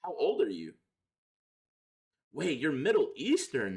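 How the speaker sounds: normal talking voice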